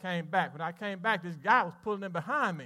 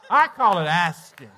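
slow and louder, each word weighed; emphatic punchline; 9 dB louder than usual. Real, not acted. Preacher answering doubters in his church, slow and emphatic. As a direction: land the point firmly. Sermon punchline after story.

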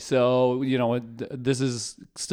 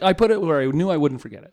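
smug certainty; 5 semitones higher, 7 dB louder than usual. Retold or imitated. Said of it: "when you're like".

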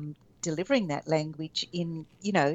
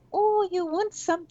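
put-on much higher, drawn-out baby-talk voice; babifying baby talk; 14 semitones higher, slower than usual. Shown as a demonstration. Adult echoing a small child's words, babifying baby talk. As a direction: coo it slowly and high, as if to a baby.

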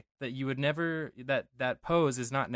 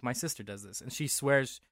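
ordinary talk

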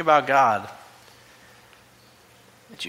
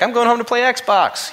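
high, louder mocking voice of a lazy twenty-something; mock lazy young guy; 12 semitones higher, 7 dB louder than usual. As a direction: tease the slacker, pitched way up. Mocking a lazy twenty-six-year-old.

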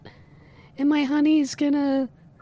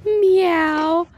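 a light, higher cat meow as a demo; 4 semitones higher, 4 dB louder than usual. Shown as a demonstration. Showing Ted how to meow like a cat, a light mock meow. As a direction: show the caller how to meow. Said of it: "Just go".

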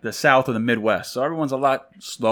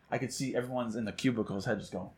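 ordinary delivery

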